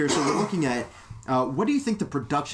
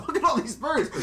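much higher, laughing through it; laughing joke. Pretend. Joking about shooting birds from the sky, laughing high. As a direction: giggle out a silly imagined line.

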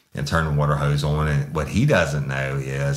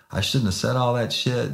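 high, gushing apology; groveling apology; 9 semitones higher than usual. Retold or imitated. Suspect apologizing to the sergeant, high and groveling. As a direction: gush sorry like you mean it.

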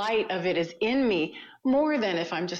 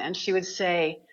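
ordinary talk, nothing marked

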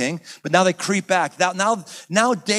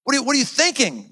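higher, incredulous dude-what-are-you-thinking; mock exasperated; 9 semitones higher than usual. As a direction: shake him awake with disbelief. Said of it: "grabs him by the lapels".

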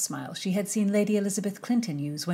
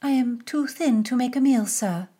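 coy; 6 semitones higher, 4 dB louder than usual. Acted. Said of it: "coy was not in her arsenal".

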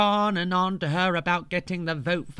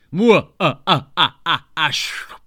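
loud gloating villain cackle; evil laugh; 7 dB louder than usual. Acted. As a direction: cackle triumphantly.

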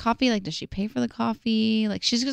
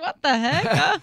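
slow, drawn-out disbelief; mock bewildered; stunned; 6 semitones higher, much slower than usual. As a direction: stretch it out in shock. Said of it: "I was like".

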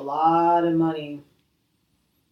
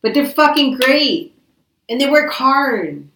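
louder and higher, fired up about workers; emphatic praise; 7 semitones higher, 9 dB louder than usual. Real, not acted. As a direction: raise it up with real admiration. Praising hardworking tradesmen emphatically.

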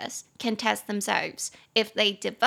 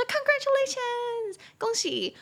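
high, drawn-out cheer; friends' congratulations; slower than usual. Acted. Friends greet the newly engaged outside the door, excited cheering. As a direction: cheer it out in a long high squeal. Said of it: "就是我亲朋好友就说".

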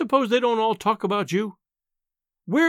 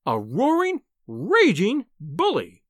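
outraged scolding; 3 semitones higher, slower than usual. A narrator's reading. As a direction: hurl the insult with relish. Wife berates husband in story.

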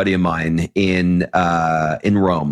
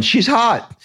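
pitched way up in playful appreciation; mock admiring exclamation; 12 semitones higher than usual. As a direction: exclaim it high, as a retold reaction. Acts out his own admiring reaction.

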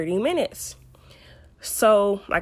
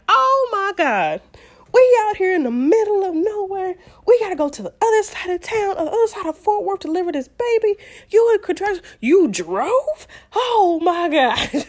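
high, loud mock-outraged rant; exasperated tirade, imitated at high pitch; upset husband; 11 semitones higher, 4 dB louder than usual. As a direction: rant in disbelief, drawing out the exclamations. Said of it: "he was so upset".

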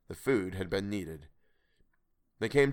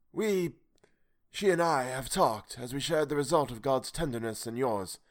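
higher, grave and sorrowful stranger; higher, solemn voice out of the night; the strange voice; 4 semitones higher than usual. Acted.

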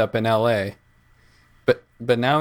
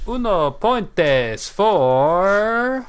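slow, drawn-out game show announcer; 8 semitones higher, 5 dB louder, much slower than usual. Acted. Announcing score in mock Spanish.

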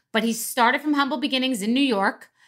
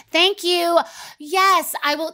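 higher, sugary waitress reply; overly nice high voice; 7 semitones higher, slower than usual. Retold or imitated. A cocktail waitress to a customer, high and overly nice. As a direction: sound nice, mean the opposite. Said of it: "overly nice, high-pitched voice".

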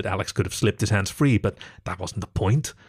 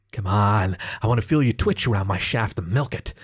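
ordinary delivery

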